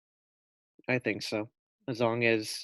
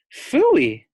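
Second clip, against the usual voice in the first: high, loud scornful phooey; scornful cry; 8 dB louder than usual. A narrator's reading. Mother scoffing at magic jelly beans, loud and scornful. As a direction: spit it out in disgust. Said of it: "cried his mother".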